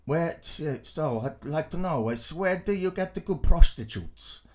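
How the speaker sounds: put-on Dutch accent. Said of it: "that was my kind of Dutch accent".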